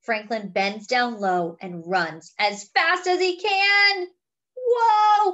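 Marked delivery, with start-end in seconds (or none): 4.6-5.3 loud high whoa of flight; thrilled whoa. Acted. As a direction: cry out as you take off. Dragon takes off running.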